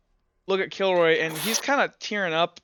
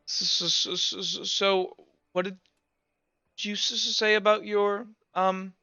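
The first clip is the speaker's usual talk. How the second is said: nervous stutter, pitch raised, halting; awkward stammer; 5 semitones higher than usual. Acted. Kilroy stammering awkwardly at a grieving friend, slightly higher. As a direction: stumble over every start like you can't face them. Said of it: "just awkwardly kinda".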